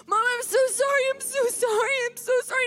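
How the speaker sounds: high, pleading imitation of a scared child; tearful child apology. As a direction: apologize over and over, as an upset little boy. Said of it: "and he's crying, and he's so scared".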